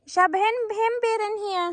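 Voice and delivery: high, slow call across the garden; yelling across the room. Real, not acted. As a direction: call out to someone far off. Calling son over from garden.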